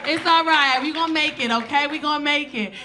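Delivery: hammed-up rallying consolation, pitched high. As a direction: play a flight attendant loudly reassuring a sobbing passenger.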